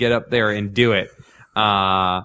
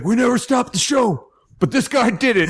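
high, crazy frontman shout; much higher, hammed-up stage holler; 11 semitones higher than usual. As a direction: imitate a crazy-voiced singer scolding the crowd from stage. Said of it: "he's got the crazy voice".

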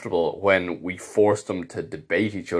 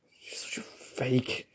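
breathy whispered jab; 6 semitones higher, 10 dB quieter, slower than usual. Retold or imitated. Judge whispering to himself about a colleague, whispered. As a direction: whisper it like a secret insult. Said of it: "it's just hours of me going".

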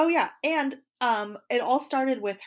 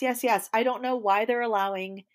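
ordinary talk, nothing marked

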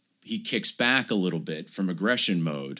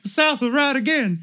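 very high, loud character voice; a bungled Dudley that lands elsewhere; wrong-guy voice; 4 dB louder than usual. Retold or imitated. Brother declaring, very high loud cartoon voice. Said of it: "he's like".

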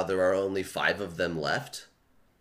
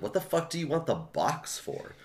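incredulous; 7 semitones higher, 5 dB quieter than usual. Retold or imitated. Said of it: "And I'm like".

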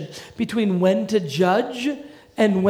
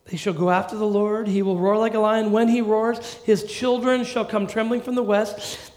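scripture read; 3 semitones higher, 4 dB louder than usual. Acted. Rereading the passage louder.

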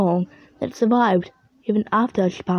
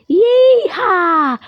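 cartoon yeehaw shout; 9 semitones higher, 8 dB louder than usual. Character whoops joyfully.

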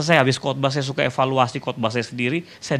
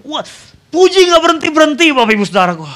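amazed exclamation; 11 dB louder than usual. Real, not acted. Preacher telling congregation of endless praise, loud amazed exclamation. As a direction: exclaim it at full voice, much higher.

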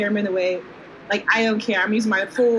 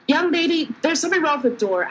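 higher, fussy call downstairs; raised pitch, flustered customer; calling out complaint; 6 semitones higher than usual. Acted. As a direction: call down politely but put out. Calling downstairs to barkeep's daughter.

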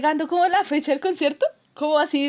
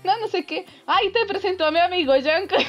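ordinary talk

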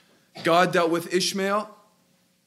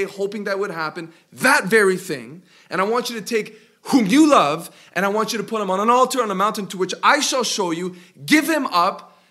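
louder, higher sermon voice building in fervor; full preaching; 5 semitones higher, 6 dB louder than usual. Acted. Pastor pressing the point to his church, fervent preaching. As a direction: preach it out to the congregation.

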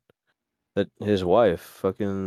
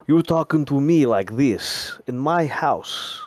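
slow, higher mock vampire voice; theatrical vampire accent, drawn out and raised; fancy vampire; 5 semitones higher, 4 dB louder, slower than usual. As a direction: play a hammed-up fancy vampire. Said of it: "like a fancy vampire".

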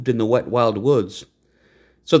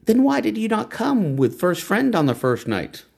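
high, proud woman's voice; lofty woman naming the horse; 10 semitones higher than usual. A narrator's reading. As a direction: announce the horse's new name.

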